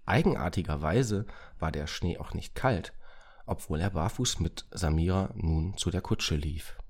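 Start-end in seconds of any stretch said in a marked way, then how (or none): none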